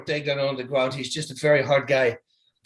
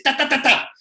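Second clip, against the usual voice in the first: quick, high "ta, ta, ta" beats, each one clipped off short; high pitched ta sounds; 10 semitones higher, 5 dB louder than usual. Shown as a demonstration. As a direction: tap out the punches as sharp little high syllables. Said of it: "high pitched sounds".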